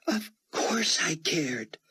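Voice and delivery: slow, breathy hushed whisper. A narrator's reading. Chloe whispers to Buck that she cared. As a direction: barely let the words out.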